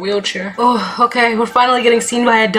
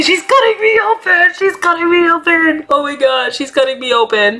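much higher, panicked mock cry; hammed-up panic; 6 semitones higher than usual. Patient panicking as doctor operates, much higher mock panic. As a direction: overact the horror of surgery. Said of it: "I'm such a good actor".

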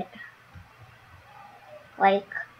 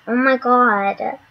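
ordinary talk

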